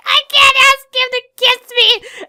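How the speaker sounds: high, loud mock wail; hammed-up tearful outburst; imitated crying. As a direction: wail it like an overwrought anime heroine. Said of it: "you know, crying".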